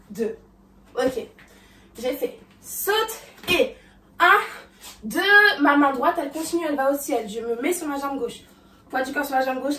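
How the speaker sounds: normal talking voice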